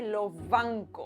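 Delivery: "lo banco" drawn out very slowly, s fused with b; very slow demo. Shown as a demonstration. Teacher slowly showing the fused sound. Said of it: "S B se funde en F".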